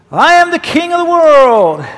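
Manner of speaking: high, loud, triumphant cry from the ship's bow; Jack's triumphant shout. Retold or imitated. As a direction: shout it out like you own the world. Jack at ship's front, shouting.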